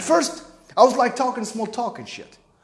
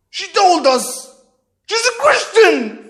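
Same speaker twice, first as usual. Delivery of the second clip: high, loud, mounting accusation; angry father; 8 semitones higher, 5 dB louder than usual. Retold or imitated. Father confronting son with what Sharzad told, rising fury. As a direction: accuse him, climbing to an outraged peak. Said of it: "I know the tone in his voice".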